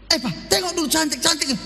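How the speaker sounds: lebih tinggi, merengek minta dilihat; mendesak manja. Pretend. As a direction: istri minta dipuji. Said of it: "istri mendesak suami". (rengekan istri, lebih tinggi)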